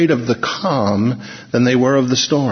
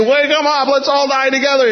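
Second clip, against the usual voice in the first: high-pitched cry from a storm-tossed boat; mock screaming; 12 semitones higher than usual. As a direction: imitate sailors screaming in a storm. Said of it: "They're screaming".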